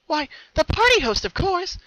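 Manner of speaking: very high, loud, giddy; giggly and happy. Acted. Pinky answering her puzzled sister, giddy. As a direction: bubble over with glee. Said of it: "She giggled happily".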